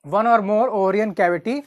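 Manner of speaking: very slow, each word set apart; slow dictation. Shown as a demonstration. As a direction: dictate it slowly for notes. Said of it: "तो ovary has". (धीरे-धीरे बोलकर लिखवाना)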